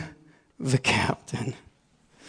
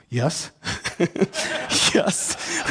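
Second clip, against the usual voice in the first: laughing yes; 3 semitones higher than usual. Retold or imitated. Laughing reply to inner voice.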